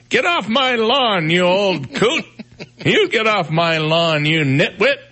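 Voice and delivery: put-on high, slow grumpy old geezer yelling; cranky old man voice. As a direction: squawk it like an angry old neighbor. Voicing grumpy old rockers feuding.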